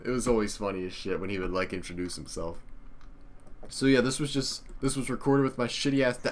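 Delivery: ordinary delivery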